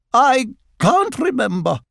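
high-pitched, grand admission; haughty otter. A narrator's reading. Otter forgetting her own questions, haughty. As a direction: say it airily in a high, lofty voice.

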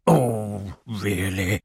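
low, subdued indignation; exasperated. A narrator's reading. Owl finding a second warning on his page, exasperated. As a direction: drop the voice in weary disbelief.

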